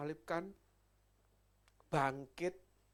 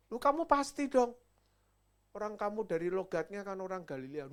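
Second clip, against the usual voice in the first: accusing shout; 4 semitones higher, 9 dB louder than usual. Acted. Bystander accusing Peter.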